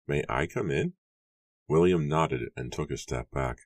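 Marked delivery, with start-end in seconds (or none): 0.1-0.9 lightly voiced visitor, rising question; Matthew's polite request. A narrator's reading. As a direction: ask politely, pitch climbing at the end. Matthew's character voice at the door.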